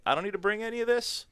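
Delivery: high, fast, pleased; mock excited rush; excited relief. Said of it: "it was like".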